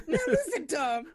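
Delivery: high falsetto rock star voice. Pretend. Impersonating [name] lecturing.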